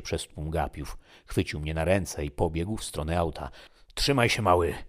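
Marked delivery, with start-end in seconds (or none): 3.9-4.7 put-on loud urgent shout to the hurt boy. Stranger shouting to the injured boy, loud urgent call. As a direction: yell it out to keep him going. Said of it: "krzyknął".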